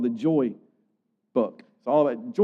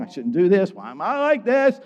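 rising into high, exasperated questioning; acted self-reproach; 5 semitones higher, 4 dB louder than usual. Preacher voices Paul questioning his hateful thoughts, exasperated. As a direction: climb in pitch with mock frustration.

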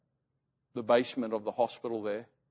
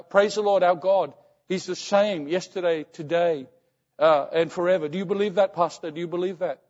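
imitated fervent mother, higher and louder; praising God; 4 semitones higher, 5 dB louder than usual. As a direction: proclaim it insistently as the believing mother. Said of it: "she was praising God".

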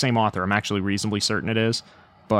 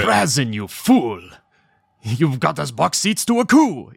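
theatrical Necron outburst, pitched up on the second sentence; 4 semitones higher, 3 dB louder than usual. Retold or imitated. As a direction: imitate an audiobook narrator's grand Necron. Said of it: "how does he voice Necrons?".

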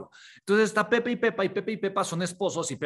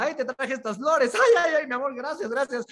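nervous wife; 7 semitones higher, 3 dB louder than usual. Retold or imitated. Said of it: "está nerviosa".

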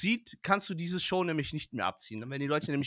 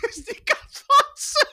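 slow words squeezed through laughter; high, loud, cracking up; laughing; 9 dB louder, much slower than usual. Pretend. Laughing mock introduction.

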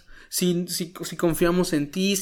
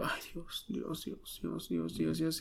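breathless, whispered repeated call to God; anguished whisper; 15 dB quieter, much slower than usual. Retold or imitated. Son retelling his panic over his mother, anguished whisper. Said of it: "con la angustia de como".

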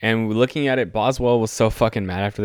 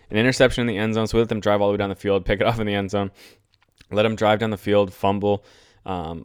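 ordinary talk

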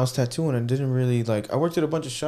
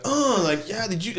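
much higher, excited, surprised; excited surprise; 10 semitones higher, 4 dB louder than usual. Retold or imitated. Tattooer reacting to client praise, excited surprise. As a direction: react like it blew you away. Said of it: "just like".

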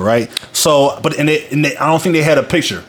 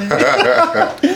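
very high, slow mock falsetto; a hammed-up falsetto mocking the word; much slower than usual. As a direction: stretch it out in a squeaky falsetto. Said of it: "sound like a transvestite".

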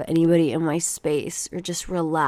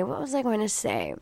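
ordinary talk, nothing marked

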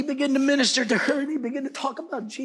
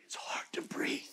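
faint, voiceless imitation of the dying; breathless whisper; 13 dB quieter than usual. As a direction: barely whisper it, out of air. Quotes dying father's words.

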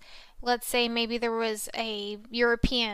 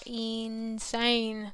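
drawn out; 4 dB quieter than usual. Real, not acted.

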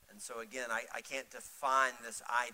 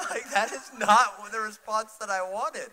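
loud, high comic complaint; laughing mock protest; 8 semitones higher, 13 dB louder than usual. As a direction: protest in amused disbelief through laughter. Comic protest, laughing, after wife's reply.

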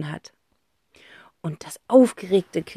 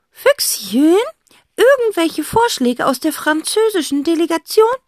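high aunt voice, teasing; eccentric aunt; 10 semitones higher, 5 dB louder than usual. A narrator's reading. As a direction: ask the fox playfully. Aunt addresses fox.

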